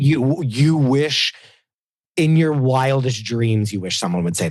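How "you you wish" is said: mocking scorn. Mocking the guy's line.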